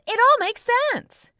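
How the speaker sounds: put-on a child shouting a sudden realisation. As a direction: yell it out in a high voice. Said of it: "Lila shouts".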